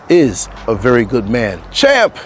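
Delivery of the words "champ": high, loud call of "Champ!". Retold or imitated. Recalling his father-in-law's videos, a loud high call. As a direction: shout it like in the old videos. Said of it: "Remember the videos?".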